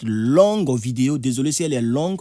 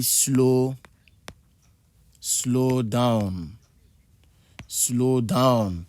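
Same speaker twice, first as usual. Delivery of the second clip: drawn-out, measured "slow down"; stretched-out teaching demo; slow demonstration; much slower than usual. Shown as a demonstration. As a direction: say "slow down" slowly, spaced out with pauses. Teacher models the English phrase slowly.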